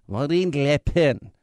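slow hammed French "Marine Le Pen"; a mock French "Marine Le Pen", slow like Pepe Le Pew; mock French accent. Shown as a demonstration. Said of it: "like Pepe Le Pew".

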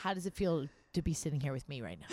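low, soft self-important punchline; imitating a TV host turning praise on himself, hushed smugness; Stephen Colbert. Drop low and quiet, mock-smug. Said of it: "He goes".

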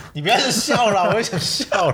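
giggling high through the words; about to crack up laughing. Real, not acted.

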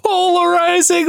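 high, disbelieving echo; incredulous. Real, not acted. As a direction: repeat it in total disbelief.

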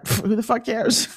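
scoffing, laughing retort; blunt New York shrug through a laugh; dismissive New Yorker. Retold or imitated. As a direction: brush it off with a scoff. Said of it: "being a New Yorker".

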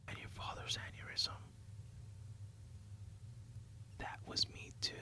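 breathy whispered confession, very soft; whispers. Acted. Killer wife whispering a confession into her husband's ear. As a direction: lean in and breathe it into his ear. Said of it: "and whispers into his ear".

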